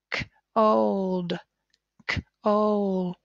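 put-on "K, old" split with a long "ole"; sounding out. Shown as a demonstration. Teacher sounding out a word for pupils. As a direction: segment with a long O. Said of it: "It's got the ole".

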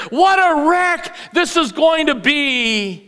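lightly acted, high and slow gloat; gloating spider. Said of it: "laughed the spider".

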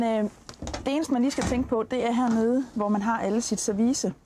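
ordinary talk, nothing marked

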